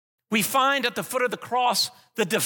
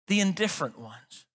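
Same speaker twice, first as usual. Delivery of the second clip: normal talking voice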